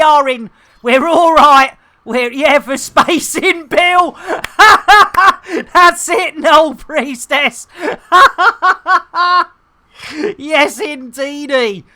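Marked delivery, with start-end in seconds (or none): 4.2-5.7 loud, high burst of laughter; real laugh, high and loud. Real, not acted. Streamer amused at Bill in space, laughing. 7.7-9.5 loud, high, rolling laugh. Real, not acted. Streamer cracking up over Bill, laughing.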